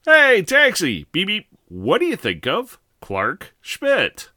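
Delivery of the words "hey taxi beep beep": high, loud cab hail; hailing a taxi. Acted. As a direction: call out for a cab, then honk.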